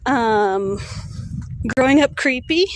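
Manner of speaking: ordinary delivery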